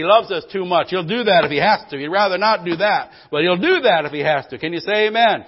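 preacher ramping up; rising preaching. Acted. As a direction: build the sermon back to a roar. Preaching builds back up.

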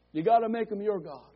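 ordinary talk, nothing marked

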